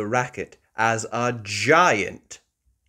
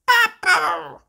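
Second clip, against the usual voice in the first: put-on very high, loud toddler squeak; George voice; 9 dB louder than usual. Little George trying to talk, high squeaky toddler. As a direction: blurt it like a tiny piglet. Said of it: "says George".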